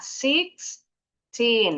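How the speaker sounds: put-on "sixteen" in two separate beats, six, teen; split into syllables. Shown as a demonstration. Teacher counting syllables for learners, split into syllables. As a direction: break "sixteen" after six, drawing each part out. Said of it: "dua suku kata".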